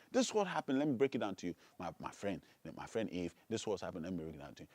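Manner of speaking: low, soft, coaxing tempter; hushed, friendly persuasion; confiding devil. Acted. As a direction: take a friend quietly into confidence. Devil confides in his friend Eve.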